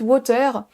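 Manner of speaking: water with no stress on wa, French-style; unstressed first syllable. Shown as a demonstration. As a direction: say water evenly, landing on the last syllable. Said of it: "Si vous n'accentuez pas".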